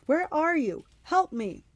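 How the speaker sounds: high Cyclops cry for help; calling out. A narrator's reading. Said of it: "the older Cyclops called".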